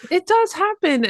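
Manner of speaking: high, loud, eager "it does happen"; emphatic agreement. Real, not acted. Host eagerly agreeing with guest's surprising story, excited. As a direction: jump in keenly to agree.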